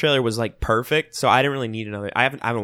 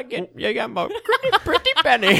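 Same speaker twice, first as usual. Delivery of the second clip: high laughing granny voice; doting grandparent; 13 semitones higher than usual. Acted. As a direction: coo like an old grandma. Joking grandma voice after remark.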